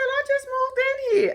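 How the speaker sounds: high-pitched imitation of a woman; woman's defensive reply. Storyteller mimicking woman who just moved in, defensive.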